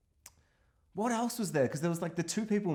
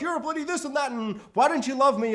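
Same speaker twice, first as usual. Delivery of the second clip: much higher, louder mock-pleading girlfriend; upset girlfriend; 11 semitones higher, 6 dB louder than usual. Grace Kelly's character arguing with her partner, high and loud. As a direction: plead with him in an exaggerated way. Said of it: "And she's like".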